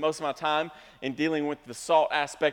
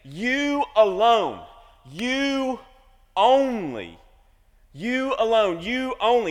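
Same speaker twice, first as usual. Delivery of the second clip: "You alone" and "you only" drawn out slow and louder, each word weighed; slow down and hammer "alone" and "only"; emphatic, slow; 3 semitones higher, 5 dB louder, slower than usual. Shown as a demonstration. Pastor unpacking the emphatic Greek for the church, slow and weighty. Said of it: "carrying a very strong meaning".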